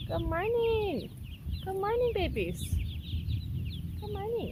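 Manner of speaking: high, slow sing-song coo. Real, not acted. Cooing morning greeting to chicks.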